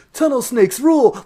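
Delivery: loud, high mock gang cheer; shouted catchphrase. Quoting a game catchphrase to friends, loud mock cheer. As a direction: belt it out like a rallying cry.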